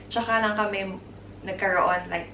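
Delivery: ordinary talk, nothing marked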